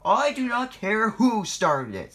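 high, loud, slow telling-off; cross. A narrator's reading. Boss silencing the excuses, crossly. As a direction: cut in crossly, each word weighty. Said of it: "said Scott Matt crossly".

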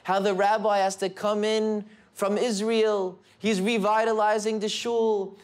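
usual speaking voice

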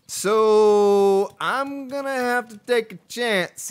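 drawn-out country twang; real country accent, opening high and loud. Real, not acted. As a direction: slip into a slow country accent. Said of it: "I said that with a country accent".

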